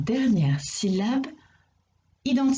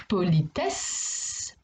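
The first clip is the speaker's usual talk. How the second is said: slow drawn-out politesse; much slower than usual. Shown as a demonstration. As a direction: stretch out politesse, letting the ending ring. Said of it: "vitesse rime avec politesse". (politesse très lent, appuyé sur la fin)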